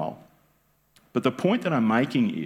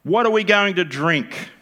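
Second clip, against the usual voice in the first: higher, louder mock complaint; the people grumble at Moses, louder and higher; grumbling complaint; 8 semitones higher, 5 dB louder than usual. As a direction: play the grumbling crowd.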